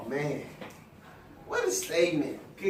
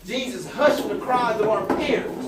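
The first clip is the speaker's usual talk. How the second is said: louder preaching build; 4 dB louder than usual. Real, not acted. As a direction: drive the sermon home. Preaching at full force.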